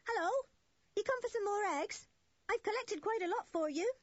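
put-on high-pitched, friendly young farm boy; eager farm boy. A narrator's reading. Farm boy calls to the visiting children, eager high voice. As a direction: greet visitors in a high boyish lilt.

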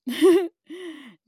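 high, loud laughing burst; loud burst of laughter. Real, not acted. Laughing at Jeremy's wording, loud burst of laughter. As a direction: crack up out loud.

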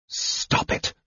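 sharp hissed rebuke; a quiet voiceless snap; snapped. A narrator's reading. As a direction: cut him off in a hiss. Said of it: "Raistlin snapped".

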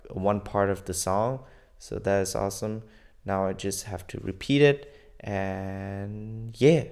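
normal talking voice

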